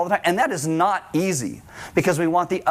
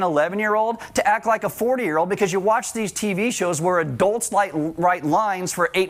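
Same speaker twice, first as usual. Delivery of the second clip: ordinary talk, nothing marked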